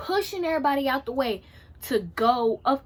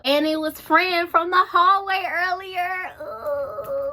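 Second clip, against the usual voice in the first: high, loud, drawn-out wailing reveal; hammed-up emotional squeal, stretched out; dramatic reveal; 7 semitones higher, 4 dB louder, slower than usual. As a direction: wail the surprise out slowly, pitched up. Dramatic relieved reveal of the friend.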